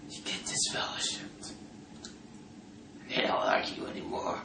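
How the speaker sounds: breathy whisper, dropping away; unvoiced whisper of doom. Real, not acted. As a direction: hush it like a dark secret. Dramatic whisper of shunning.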